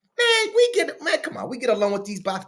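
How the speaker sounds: ordinary talk, nothing marked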